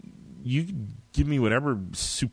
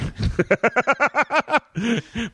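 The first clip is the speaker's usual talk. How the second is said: high, bright burst of laughter; real laugh; hearty laugh. Real, not acted. Laughs at his own joke.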